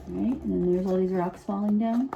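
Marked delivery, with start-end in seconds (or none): none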